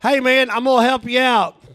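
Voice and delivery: high, loud bar-patron impression; imitating one-eyed dude. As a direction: call out the offer high and loud, as the little dude. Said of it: "the dude stands back up, and he's like".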